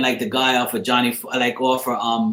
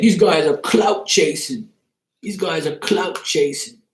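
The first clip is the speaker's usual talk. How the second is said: higher, slow mocking imitation; imitating him; 6 semitones higher than usual. Retelling the streamer's complaint about clout chasers, mocking imitation. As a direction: drag it out like a mocking parrot. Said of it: "he said".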